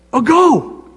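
high, light push "Oh, go"; God urging. Retold or imitated. Preacher voicing God nudging the hesitant believer, high. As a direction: nudge them on lightly. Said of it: "But God says".

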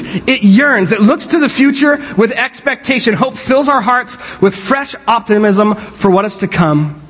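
normal talking voice

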